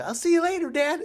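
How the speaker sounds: put-on high, chirpy kid voice, waving bye; lightly voiced daughter gliding past; breezy little girl.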